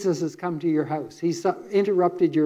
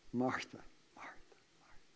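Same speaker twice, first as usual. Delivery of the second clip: soft whispered Martha, Martha; hushed tender calling of a name; 6 semitones lower, 20 dB quieter than usual. Acted. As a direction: breathe her name gently, unvoiced. Jesus gently calling Martha.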